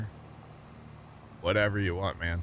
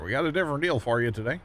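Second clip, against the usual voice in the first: fast, high, pushy auctioneer patter; 5 semitones higher, faster than usual. Shown as a demonstration. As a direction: rattle it off like an auctioneer selling. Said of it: "Gotta sound like an auctioneer".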